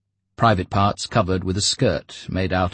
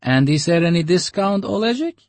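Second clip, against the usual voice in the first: higher, louder coaxing; wheedling broker; 12 semitones higher, 6 dB louder than usual. Acted. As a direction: coax a discount. Broker haggles.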